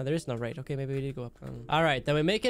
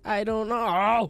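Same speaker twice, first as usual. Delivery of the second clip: higher, slow, stretched-out whine; drawn-out whine; 5 semitones higher, much slower than usual. Real, not acted. Lost player moaning to viewers, slow whine. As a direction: draw it out like a helpless groan.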